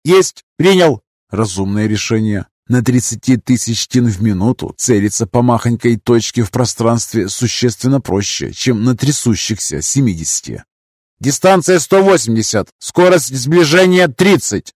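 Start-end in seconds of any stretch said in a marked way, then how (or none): 0.0-1.0 high crisp operator voice; combat callout. Acted. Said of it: "доложил оператор Грэб". 11.2-14.7 high crisp operator voice; combat callout. Acted. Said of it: "доложил оператор Грэб".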